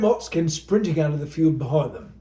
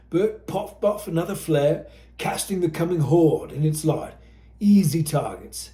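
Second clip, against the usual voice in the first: usual speaking voice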